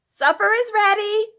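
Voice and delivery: high, loud call into the next room. A narrator's reading. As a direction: call them to the table. Wife calls into the room.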